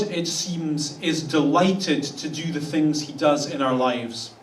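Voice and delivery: ordinary talk, nothing marked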